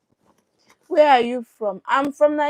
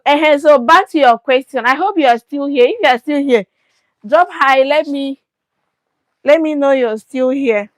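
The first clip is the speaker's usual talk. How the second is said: calling out; 3 semitones higher, 6 dB louder than usual. Real, not acted. Calling out to live viewers.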